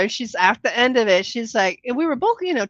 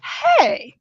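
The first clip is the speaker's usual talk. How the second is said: eager hey; 9 semitones higher, 3 dB louder than usual. Retold or imitated. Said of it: "she's like".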